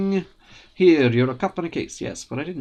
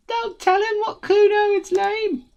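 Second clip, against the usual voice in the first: put-on high, sneering kid voice; mocking sidekick kid; 5 dB louder, slower than usual. Cuno's friend teasing Kuno, high sneering kid. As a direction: needle your buddy, much higher.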